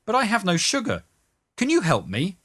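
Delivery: high-pitched, pleading request; wheedling hustler. A narrator's reading. Second hustler asks a favour.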